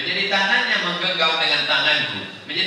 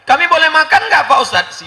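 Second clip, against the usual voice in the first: high, loud hopeful question; eager guest asking; 11 semitones higher, 9 dB louder than usual. Acted. Wedding guest asking the ustadz about eating, high and loud. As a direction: beg the ustadz politely for food.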